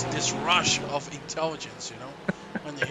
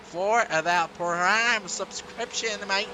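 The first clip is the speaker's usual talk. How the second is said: hammed-up Aussie, higher and louder; mock Australian accent; 4 semitones higher, 4 dB louder than usual. Thanking a subscriber on stream, mock Australian accent. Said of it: "That's Australian".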